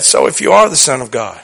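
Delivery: low, soft voice of a tempter; lightly voiced Satan, dropped low and quiet; Satan tempting. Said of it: "when Satan said".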